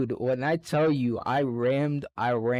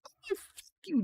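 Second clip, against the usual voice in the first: much higher, squealed mock curse; mock angry curse; 5 dB quieter than usual. Knocked-down classmate cursing him in a high mocking squeal. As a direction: play the kid who just got knocked over.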